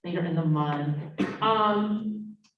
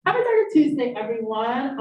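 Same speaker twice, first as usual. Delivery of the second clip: bright, loud, bubbly greeting; high energy; 5 semitones higher, 5 dB louder than usual. Real, not acted. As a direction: burst in with lots of energy. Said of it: "I have a lot of energy right now".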